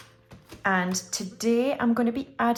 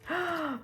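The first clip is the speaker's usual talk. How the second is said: gasp; 7 semitones higher, 6 dB quieter than usual. Real, not acted. Gasps at colouring next block.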